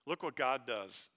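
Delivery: normal talking voice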